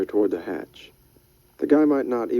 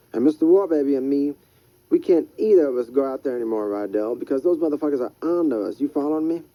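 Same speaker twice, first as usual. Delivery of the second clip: lightly raised, rough street hustler; Freddy's voice; 5 semitones higher than usual. Acted. Freddy warns Rydell about bridge people, raised hustler voice.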